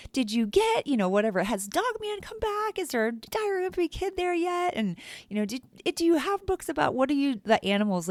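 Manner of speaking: put-on high, eager kid voices; bubbling over with questions like a child; eager kids asking. Kids asking her about books.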